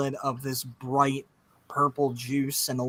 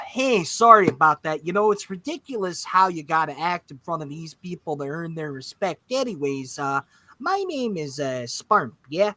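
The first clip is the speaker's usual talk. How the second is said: friendly Midwestern lilt; chatty small-town host voice; Midwestern accent. Acted. Orc drops the act, talks folksy Midwestern. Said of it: "Midwestern Spark because accents".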